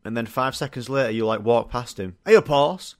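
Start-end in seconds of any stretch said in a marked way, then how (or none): 2.2-3.0 loud Yorkshire "Hey up, horse" greeting; Yorkshire accent. Retold or imitated. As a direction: bellow it like a Yorkshire lad. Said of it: "horse in the Yorkshire accent".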